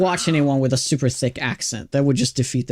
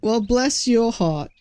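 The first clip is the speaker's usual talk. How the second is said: put-on higher, slow Southern accent; 7 semitones higher, slower than usual. Streamer giving chat an American saying in a slow Southern accent. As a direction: drawl it out sweetly, Southern style. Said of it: "That's an actual expression".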